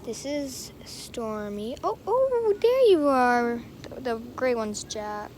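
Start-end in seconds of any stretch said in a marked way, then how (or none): 1.8-3.6 excited squeal. Real, not acted. Spotting the kitten.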